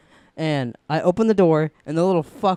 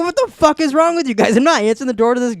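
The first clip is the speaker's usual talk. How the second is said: higher and louder, frantic disbelief; exasperated outburst; 8 semitones higher, 5 dB louder than usual. Retold or imitated. Scared kid yelling at friends on the phone, exasperated. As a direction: rant at friends who won't help.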